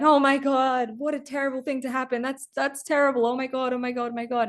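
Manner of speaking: hammed-up dismay, high and breathless; horrified villagers. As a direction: fret like neighbours hearing bad news. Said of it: "everyone's like".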